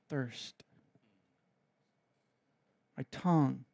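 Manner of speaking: hushed scripture reading. Acted. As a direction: let each phrase fall into silence. Slow quiet scripture reading.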